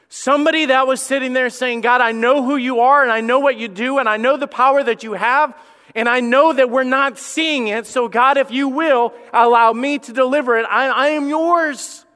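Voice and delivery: high, loud impassioned plea; fervent preaching. Real, not acted. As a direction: cry out the prayer with passion. Preaching climax, rising fervour.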